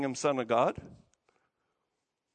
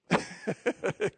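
brief amused laugh without words; short chuckle. Real, not acted. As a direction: chuckle at the congregation's response. Amused at congregation's response.

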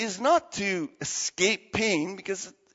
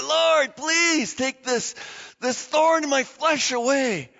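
raised, desperate plea to God, slow on please; pleading prayer; 7 semitones higher, 4 dB louder, slower than usual. Retold or imitated. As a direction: beg God out loud to take the thorn away. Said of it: "he pleaded with God".

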